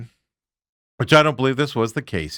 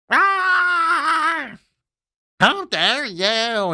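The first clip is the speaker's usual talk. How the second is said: high theatrical cry, then a slow drawn-out how dare you; hammed-up offended shriek; mock outrage; 12 semitones higher, much slower than usual. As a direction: overplay the wounded diva, voice way up. Mocking players acting offended.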